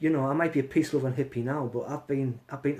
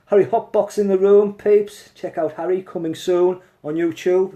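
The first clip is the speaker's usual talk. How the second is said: excited shout-out; 3 semitones higher, 9 dB louder than usual. Real, not acted. Hyping up a viewer loudly.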